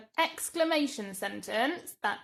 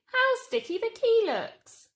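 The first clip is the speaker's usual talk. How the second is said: much higher and louder, full of disgust-tinged wonder; exclaimed; 13 semitones higher, 6 dB louder than usual. Shown as a demonstration. Teacher exclaiming the sticky key sentence to children. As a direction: really exclaim it. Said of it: "it's an exclamation sentence".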